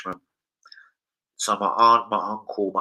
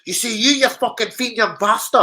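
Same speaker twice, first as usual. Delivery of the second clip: mock Scottish insult; 10 semitones higher, 4 dB louder than usual. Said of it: "I was like".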